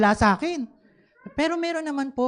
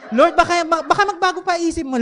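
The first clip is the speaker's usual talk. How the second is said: excited plea; 8 semitones higher, 6 dB louder than usual. Retold or imitated. Pleading with God, laughing.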